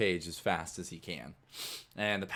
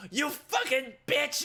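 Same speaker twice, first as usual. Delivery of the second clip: high, drawn-out angry yell; furious outburst; 6 dB louder, slower than usual. Acted. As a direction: explode at a cellmate in a staged fight. Scooter starts staged fight.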